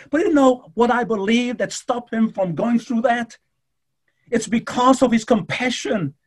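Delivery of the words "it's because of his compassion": ordinary talk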